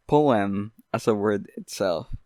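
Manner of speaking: normal talking voice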